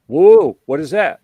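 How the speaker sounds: sudden loud, high surprised whoa. Retold or imitated. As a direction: blurt it out, caught off guard. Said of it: "and he's like".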